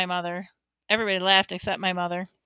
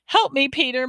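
higher, urgent plea; begging; 6 semitones higher, 3 dB louder than usual. A narrator's reading. Mother to her son for help, begging. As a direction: plead for help. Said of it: "Mom begged".

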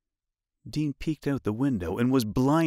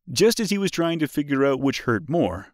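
plain reading voice

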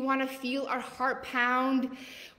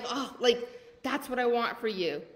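ordinary talk

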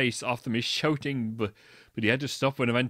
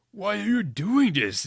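higher, louder hammed-up angry question; 8 semitones higher, 4 dB louder than usual. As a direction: demand it with mock fury. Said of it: "Lupin asked angrily".

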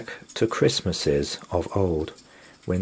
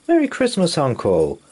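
high, loud cheerful call to his uncle; cheery call; 10 semitones higher, 6 dB louder than usual. A narrator's reading. Nephew greets his uncle at Christmas, calling out cheerily. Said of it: "called his cheery nephew".

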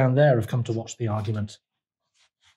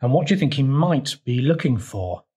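ordinary delivery, nothing marked

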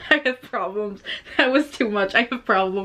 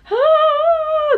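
startled yelp; 6 dB louder than usual. Real, not acted. Startled by the clip.

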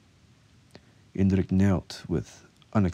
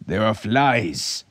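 slow, raised, spitting revulsion; disgusted; 4 semitones higher, 5 dB louder, much slower than usual. A narrator's reading. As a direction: spit it out in disgust. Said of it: "spitting in disgust".

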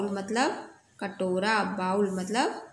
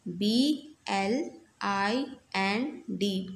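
letters B L I N D spelled out slowly; slow spelling; much slower than usual. Shown as a demonstration. Teacher spelling blind for students, slow and clear. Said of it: "spells the word".

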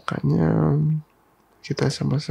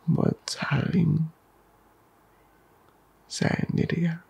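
ordinary delivery

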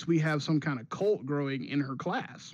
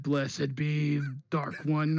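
slow, dark demon blessing; slower than usual. Retold or imitated. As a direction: bless her like a dark lord. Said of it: "talk in the demon voice".

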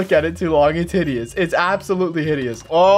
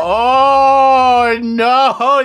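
drawn-out, rising, louder groan of no; loud dismay; 7 semitones higher, 7 dB louder than usual. Real, not acted. Seeing more villagers in the zoo, loud dismay. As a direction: wail it long and high.

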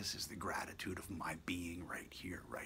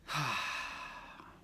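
long audible exhale; 5 semitones higher than usual. Real, not acted. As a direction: let out a big, slow breath. Deep breath before "Just breathe.

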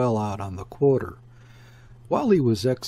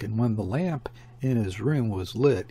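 usual speaking voice

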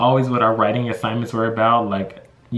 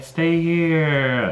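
imitated pleading; 5 semitones higher than usual. Teachers begging her to stay.

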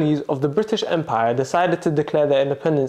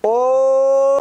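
excited oh; 9 semitones higher, 5 dB louder than usual. Real, not acted. Recognising an Office reference.

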